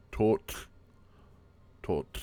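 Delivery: TOT as tohtl, ending in a voiceless tl; one-syllable tl. Shown as a demonstration. Teacher models the word for bird, one-syllable demo. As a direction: release TOT into a breathy tl without a vowel. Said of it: "T L in tot is part of the last syllable".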